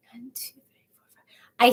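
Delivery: counting under the breath; whispered counting. Real, not acted. Working out how many offices, counting in a whisper. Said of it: "counting under her breath".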